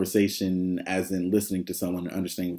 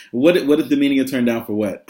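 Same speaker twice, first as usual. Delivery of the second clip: lightly voiced kids, louder and higher; insistent kids; 4 semitones higher, 6 dB louder than usual. Kids asking the teacher about a song, lightly imitated insistence. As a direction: imitate students pressing for an answer. Students pressing the teacher again.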